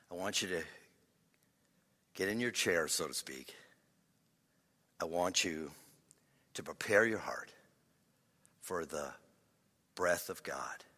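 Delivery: low, soft words with long pauses; hushed devotional invitation. Real, not acted. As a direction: settle them gently into stillness, very quietly. Leads listeners into quiet readiness.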